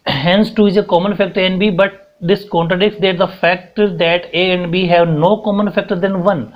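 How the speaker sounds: slow, word by word for writing down; slow dictation. Shown as a demonstration. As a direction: dictate it slowly so students can copy. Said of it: "यही बात मैंने यहाँ दो line लिखी है".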